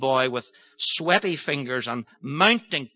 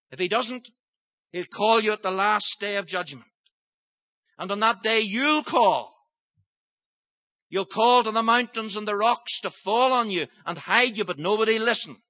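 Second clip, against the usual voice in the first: full-force preaching; 6 semitones higher than usual. Acted. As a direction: warn the hard-hearted congregation with rising intensity. Preaching warning of God's call.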